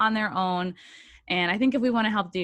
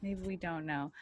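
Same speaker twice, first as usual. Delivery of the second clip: much softer repeat; quiet joking echo; 12 dB quieter than usual. Pretend. Host repeating her joke after a laugh, quiet echo. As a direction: let it drop away softly.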